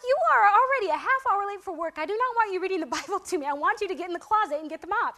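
half-laughing exasperated reprimand; retold annoyed scolding. Worker scolding her late supervisor, mock-annoyed. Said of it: "I was mad at them".

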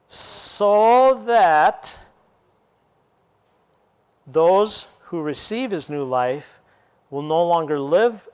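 slow, weighty scripture read. Acted. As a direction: read the verse like holy writ. Reads scripture aloud.

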